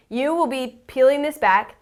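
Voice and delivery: ordinary talk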